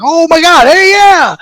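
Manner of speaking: high, loud excited squeal; gushing high and loud. Retold or imitated. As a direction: squeal it out, thrilled. Thrilled reaction to praise.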